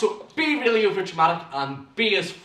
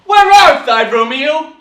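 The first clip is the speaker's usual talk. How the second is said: loud, high theatrical cry; big, high-pitched dramatic declamation; full-on performing; 11 semitones higher, 16 dB louder than usual. Shown as a demonstration. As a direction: project it to engage the audience. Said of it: "You're performing, you're engaging with your audience".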